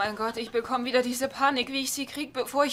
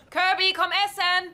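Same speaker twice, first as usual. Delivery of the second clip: louder and higher, calling across the house; 8 semitones higher, 5 dB louder than usual. Acted. Woman calling Kirby to dinner, calling out. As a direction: call the kid in to eat.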